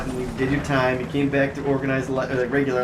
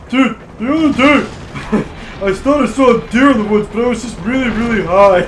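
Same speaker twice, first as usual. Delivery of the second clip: forced stoner dude voice; 8 semitones higher, 5 dB louder than usual. Retold or imitated. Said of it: "he was pushing it every time".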